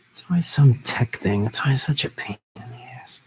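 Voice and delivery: annoyed whisper under breath. Real, not acted. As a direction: grumble it in a whisper. Annoyed aside about tech trouble.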